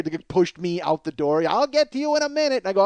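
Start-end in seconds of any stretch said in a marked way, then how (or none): 1.5-2.6 high, loud cartoonish scolding shoo; cartoon wardrobe lady. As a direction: shoo him out like a cartoon busybody. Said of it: "literally like in a cartoon".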